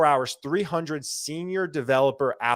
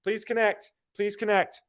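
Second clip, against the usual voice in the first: higher begging please connect, repeated; pleading; 7 semitones higher than usual. Retold or imitated. Recruiter begging conference folks, high pleading. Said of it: "chasing everybody's ass".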